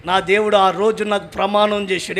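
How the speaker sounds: ordinary delivery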